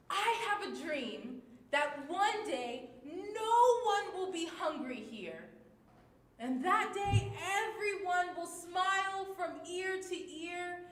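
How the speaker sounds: high, loud and bright with energy; excited. Shown as a demonstration. Teacher models an excited reading of the poem, loud and high. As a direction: read it bursting with excitement. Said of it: "read this in an excited way".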